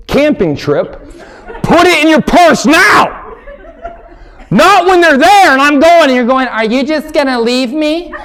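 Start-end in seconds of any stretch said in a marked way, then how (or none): 1.6-6.5 higher, louder frustrated holler; exasperated yell. Acted. Husband to wife packing her purse, exasperated yell. 6.5-8.1 whiny wife. Retold or imitated. Wife mocked complaining.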